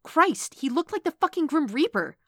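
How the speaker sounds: high, loud, appalled exclamation; outraged demanding. A narrator's reading. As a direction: exclaim in agitated disbelief. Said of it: "she demanded".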